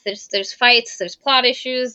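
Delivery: ordinary talk, nothing marked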